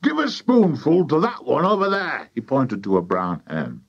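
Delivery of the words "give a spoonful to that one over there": high, eager order; excited farmer. A narrator's reading. Mister Cranky pointing out a hen, excited order. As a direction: call it out high and keen.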